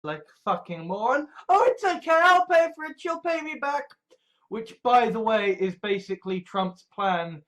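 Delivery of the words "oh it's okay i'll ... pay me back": high, loud imitation of a fool; hammed-up mocking quote. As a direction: mimic a gullible idiot, high and loud. Mocks a moron's promise to pay.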